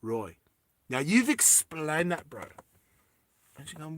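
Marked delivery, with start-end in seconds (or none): 0.0-0.4 "Roy" with a clear R; "Roy" pronounced with R, not W; correct pronunciation. Retold or imitated. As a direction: say "Roy" low, starting firmly on R. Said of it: "It is pronounced".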